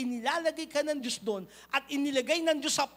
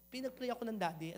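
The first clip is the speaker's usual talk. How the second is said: usual speaking voice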